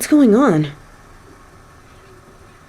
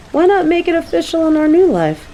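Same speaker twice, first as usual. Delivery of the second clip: eager; 12 semitones higher, faster than usual. Acted. Excited proposal to move in.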